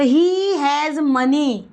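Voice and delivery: drawn out very slowly, each word apart; first slow take for learners to copy; slow model sentence. Shown as a demonstration. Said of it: "teacher models then repeats". (बहुत धीरे, हर शब्द अलग)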